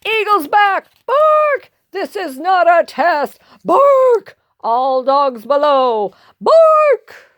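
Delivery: high barked warning shouts; watchdog alarm. Acted. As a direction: sound the siren between announcements.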